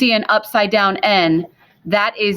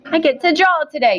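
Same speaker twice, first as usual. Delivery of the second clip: higher, quicker mock-excited kid voice; mock eager student; 7 semitones higher, faster than usual. Teacher imitating a student's excitement about drawing, mock-eager. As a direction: play the student who's thrilled to draw. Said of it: "I know what you're thinking".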